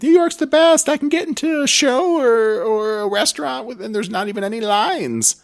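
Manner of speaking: put-on high-pitched rave review; gushing fans. Host mimics everyone raving about New York, gushing. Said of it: "Everyone's like".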